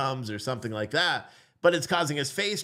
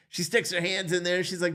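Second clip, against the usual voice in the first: ordinary talk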